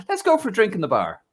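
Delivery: fast, high, pushy invitation; the stranger luring him to a bar, quick and high; Roberto voice. Retold or imitated. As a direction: rush the offer in a high, eager voice.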